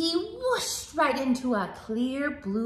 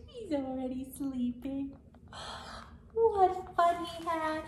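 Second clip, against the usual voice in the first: usual speaking voice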